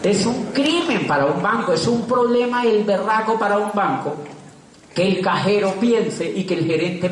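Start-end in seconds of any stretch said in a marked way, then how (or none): none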